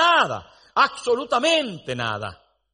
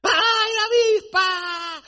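high, slow hammed-up shriek of a woman scared of wasps; mock a woman panicking at a wasp; frightened woman's cry; 12 semitones higher, slower than usual. Preacher imitating a woman he knows, hammed-up high shriek. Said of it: "Yo conozco una".

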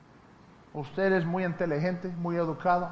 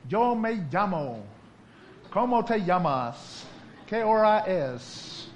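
beginner drilling phrases; 3 semitones higher, 4 dB louder, much slower than usual. Retold or imitated. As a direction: recite like a language learner. (como estudiante repitiendo frases, muy lento)

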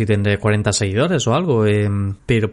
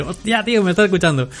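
excited high-pitched Castilian exclamation; thrilled, pitched up, in Castilian Spanish; castilian spanish accent; 6 semitones higher, faster than usual. Retold or imitated. Imagines a listener's excited reaction.

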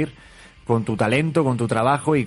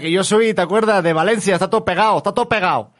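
put-on forced Spanish accent; 5 semitones higher than usual. Host answers the joke in a forced Spanish accent. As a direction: ham up a Valencian Spaniard. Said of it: "¿vieron cómo sí puedo forzar el acento español?".

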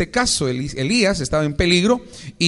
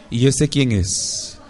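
slow, baffled question to the mirror; not recognising your own face; mock confusion at yourself; puzzled mirror voice; 3 semitones lower, slower than usual.

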